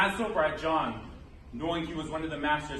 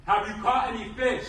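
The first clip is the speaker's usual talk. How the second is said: raised, higher call to the boat; 3 semitones higher, 4 dB louder than usual. A narrator's reading. Stranger on the beach hails the fishermen, calling out. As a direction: call it out from the shore. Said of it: "there's a call from someone".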